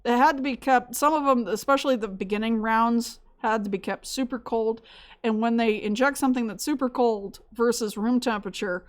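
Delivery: ordinary delivery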